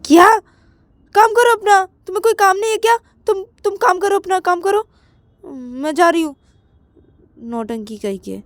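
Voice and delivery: very high loud scolding fading out; brush-off. Acted. Payal telling Ananya to mind his work. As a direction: wave him off.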